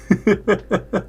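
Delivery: high bright burst of laughter; a short giggle at the story. Real, not acted. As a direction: laugh at yourself. Laughing at being bald.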